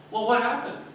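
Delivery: put-on eager kids. Children pressing the teacher.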